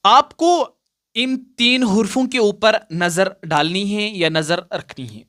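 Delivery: usual speaking voice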